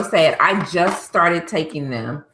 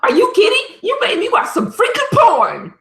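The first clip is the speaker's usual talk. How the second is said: much higher, louder indignant yell; outraged shout; 3 dB louder than usual. Real, not acted. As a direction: blast him for what he made you watch. Outraged at forced viewing.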